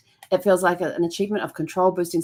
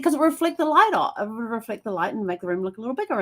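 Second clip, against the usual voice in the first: eager high justification; 5 semitones higher than usual. Retold or imitated. Justifying gloss to husband.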